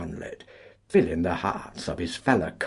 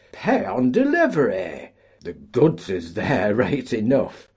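much higher, loud jeering quip; joking shout; 5 semitones higher, 7 dB louder than usual. Acted. A collier jeers at the paymaster, high and loud. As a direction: shout the joke over the crowd.

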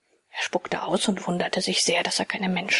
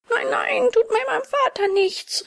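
high, pleading cry of despair; desperate plea; 13 semitones higher than usual. Acted. Puppet begs robbers to spare his father, high desperate cry. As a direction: beg for your father's life, high and frantic. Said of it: "rief Bengele verzweiflungsvoll".

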